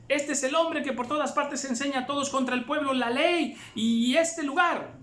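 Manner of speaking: grito agudo y fuerte de multitud alborotada; dando voces. A narrator's reading. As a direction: clamar pidiendo ayuda, muy alto. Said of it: "dando voces". (gritando agudo, pidiendo auxilio)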